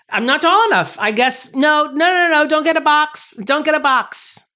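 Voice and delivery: high-pitched playful pleading; mock-flustered protest. Too short to embrace an imagined Henry Cavill, mock-flustered. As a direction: wave off imagined help in a high, fussing voice.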